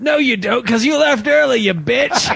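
high, teasing put-on voice calling a friend out; high catty taunt at a bluffing buddy; mocking retort. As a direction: squeal the comeback like a catty friend. Mock retort in imagined reunion.

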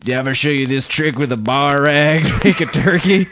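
hammed-up pushy barfly; bar weirdo. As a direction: corner a stranger with a bar trick, voice put on. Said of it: "the guy comes up, and he's like".

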